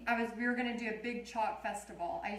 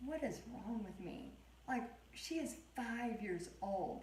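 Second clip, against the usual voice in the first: inner thought; 11 dB quieter than usual. Retold or imitated. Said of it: "I was like".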